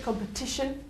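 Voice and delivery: "Competition" with the strong syllable on TI; stress demonstration. Shown as a demonstration. Teacher confirms the strong syllable for students, "Competition" stressed on TI. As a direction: lean on the third syllable of "Competition". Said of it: "Where's the strong syllable?".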